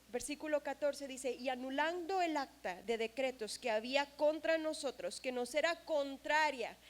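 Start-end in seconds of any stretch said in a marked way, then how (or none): 1.3-6.8 scripture reading. Acted. Reading scripture verse aloud.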